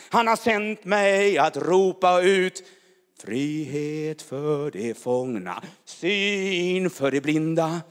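loud fervent preaching, tongues mixed in; preaching at full force. Real, not acted. Preacher crying out to the church, loud and fervent. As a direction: proclaim it like fire over the congregation.

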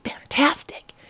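soft unvoiced whisper, eager to please; husband whispering devotion, unvoiced; whispered doting. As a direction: breathe it like a sweet secret. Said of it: "This guy was like".

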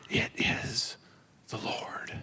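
soft, hushed whisper; quiet awe. Retold or imitated. John telling Peter in a reverent whisper. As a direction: breathe it out in reverent wonder. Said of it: "it's this quiet, like, it's not yelling".

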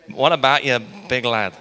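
loud Belfast greeting; Northern Irish accent. Acted. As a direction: call it out like a Belfast lad. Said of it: "anyone from Belfast can do that one".

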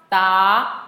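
rising "da"; second tone. Shown as a demonstration. Teacher running through four tones on one sound, "da" rising. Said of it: "One more time".

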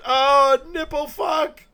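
high, loud mock scream; comic howl of pain; imitated pain yell. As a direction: yelp as the nipple gets waxed. Acting out nipple-waxing scream.

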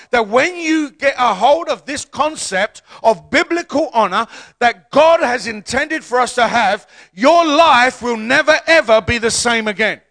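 rising, forceful preacher build; preaching. Acted.

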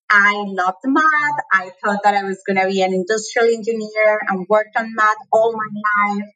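ordinary delivery

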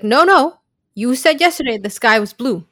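imitating an indignant correction; acted objection. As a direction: cut in insistently, acting out the argument. Acted objection in a retold fight.

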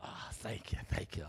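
breathy soft thank you; real hushed gratitude after the shout; whispered thanks. Real, not acted. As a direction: whisper thanks while catching your breath. Given a hanky, overcome.

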